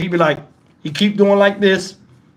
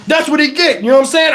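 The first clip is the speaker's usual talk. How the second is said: ordinary delivery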